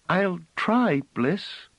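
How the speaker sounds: put-on very high piping child's voice; piping highest tones. Phelim promising Bliss, piping very high. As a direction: pipe it up at the top of her range. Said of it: "piped in her highest tones".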